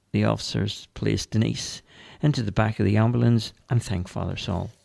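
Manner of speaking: ordinary talk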